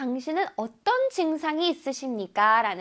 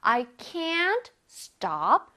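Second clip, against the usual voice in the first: very slow, careful English; slow teacher demo; slow model reading; much slower than usual. Shown as a demonstration. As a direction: read each word slowly for students. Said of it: "해볼게요".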